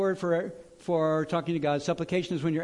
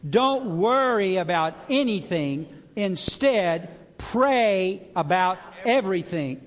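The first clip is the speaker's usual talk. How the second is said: louder, slow, emphatic declaration; mean every word of it; more conviction; 3 semitones higher, 5 dB louder, slower than usual. Shown as a demonstration. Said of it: "say that with some more conviction".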